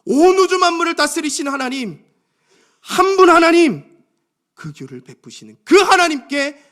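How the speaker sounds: high, loud proclamation with stretched key words; clear confession of faith. Acted. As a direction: declare God's name at full force. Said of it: "하나님을 명확하게 고백합니다". (높고 크게 외치는 신앙 고백)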